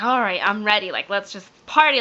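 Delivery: loud retold party cheer; excited. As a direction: pump it up, ready to celebrate. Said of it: "I was so excited".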